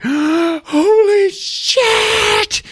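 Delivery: gasp, then a long high shriek; horrified scream. Retold or imitated. Mother finds Peter covered in ants, horrified scream. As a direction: scream it out slowly in mock horror. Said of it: "She is just horrified".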